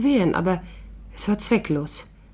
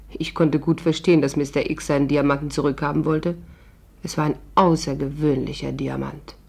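ordinary talk, nothing marked

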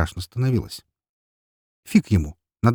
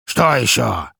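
louder, irritated question from a fed-up fat man; displeased; 3 semitones higher, 4 dB louder, slower than usual. A narrator's reading. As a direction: ask it with weary displeasure. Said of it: "недовольно спросил толстяк".

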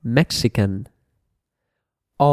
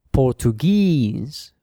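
"Portuguese" slowly, last syllable stressed and drawn out; slow teacher's model of "Portuguese", weight on the end; final-syllable stress; 2 semitones higher, slower than usual. Shown as a demonstration. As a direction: stretch the final "guese" in "Portuguese". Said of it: "falls on the final syllable".

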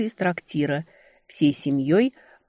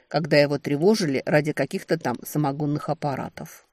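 ordinary delivery, nothing marked

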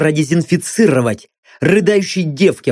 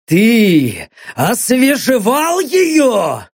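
higher, slow angry question; slow menacing demand; 6 semitones higher, much slower than usual. Acted. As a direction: drag out the threat. Interrogator demands an answer.

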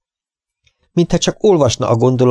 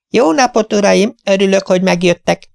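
high, bright-voiced welcome from a tiny hostess; cheery little lady; 11 semitones higher than usual. Tiny hostess welcoming the vets at her door, high and cheery. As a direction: greet the gentlemen like a delighted little old lady. Mrs Beck greets the vets.

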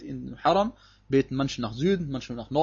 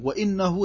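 وَإِنَّهُ with higher, emphatic pitch; Betonung auf وَإِنَّهُ; 6 semitones higher, much faster than usual. Shown as a demonstration. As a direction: lift وَإِنَّهُ to point out its emphasis. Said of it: "ist Betonung". (وَإِنَّهُ mit hoher Stimme betont)

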